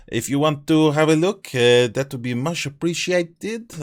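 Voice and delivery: hammed-up French accent, drawn-out words; slow, exaggerated French-accented English. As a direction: do your best French accent. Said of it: "that is my best French accent".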